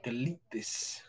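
low mock command. Two friends retelling how Nathan demanded it, low and hushed. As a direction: growl it low and soft, mock-stern.

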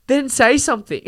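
high, pointed challenge; exasperated. Real, not acted. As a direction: snap it back as the obvious answer. Frustrated call to speak up.

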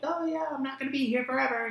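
very high mock mom voice; typical mom line. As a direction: squeak it like a guilt-tripping mom. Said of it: "how moms do it".

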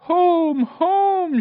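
very high, loud elated cry; shouting. A narrator's reading. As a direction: shout it out with all your heart. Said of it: "shouted Fenella".

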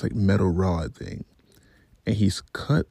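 ordinary delivery

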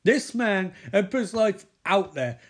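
ranting diva. Retold or imitated. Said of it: "Lawrence Fishburne's like".

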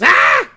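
high, loud panicked scream; freaked-out yell. Retold or imitated. Homer freaks out at Bart's answer, panicked yell. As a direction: scream in mock horror. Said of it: "freaks out and yells".

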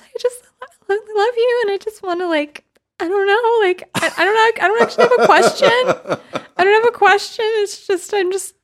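high stammering fan impression; nervous starstruck woman. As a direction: impersonate a nervous woman before a celebrity. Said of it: "such a good impression of, like, the nervous heterosexual woman".

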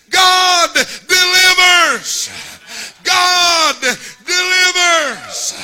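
slow, loud high preaching shout; screaming. Acted. Father searching behind every bush, slow loud scream. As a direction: stretch each word out in a cry.

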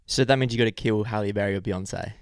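ordinary talk, nothing marked

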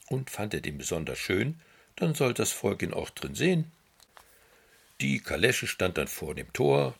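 plain reading voice

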